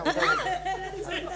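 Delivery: high, slow, laughing mockery; mocking. Girl mocking mother's praise of her father, drawn out and laughing. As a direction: drag the word out to ridicule it.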